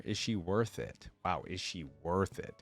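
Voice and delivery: normal talking voice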